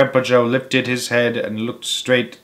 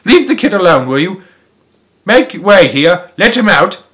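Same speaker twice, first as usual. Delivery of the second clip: commanding shout; 7 semitones higher, 6 dB louder than usual. A narrator's reading. Shopkeeper ordering the crowd.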